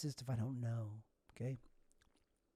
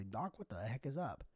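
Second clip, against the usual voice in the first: imagined plea to doctor; 5 semitones higher than usual. Pretend. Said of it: "go to the doctor and say".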